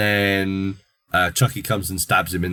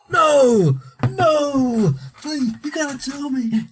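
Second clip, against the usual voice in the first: desperate pleading. Acted. Hero begging the dying man for the secret, high and frantic. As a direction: beg him to tell you, high and frantic.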